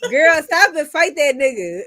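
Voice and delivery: high-pitched, laughing scolding; retold amused exasperation; laughing heckle. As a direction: egg your friend on through laughter. Retells heckling a panicking friend.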